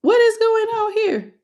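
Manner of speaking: high astonished question; high-pitched stunned what is going on here; amazed. Real, not acted. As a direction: gasp it out in disbelief. Overwhelmed by reading.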